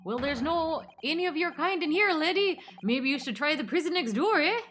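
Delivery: lilting put-on Minnesotan; dismissive governor in mock Minnesotan; Minnesotan accent. Governor turns away the foreign visitor, lilting Minnesotan accent. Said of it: "I'll just make him Minnesotan".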